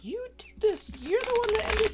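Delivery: very high, loud mock cheer; high cheering townsfolk. Townsfolk hailing Dorothy for killing the witch, squeaky high mock cheer.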